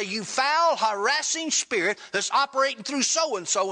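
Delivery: retold command to an evil spirit, with authority; authoritative command. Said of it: "take authority over that evil spirit".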